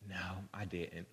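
very low, hushed little admission; barely audible, reluctant mock confession; imitated sheepish child. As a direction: imitate a kid owning up under the breath. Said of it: "And he goes".

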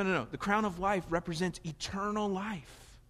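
usual speaking voice

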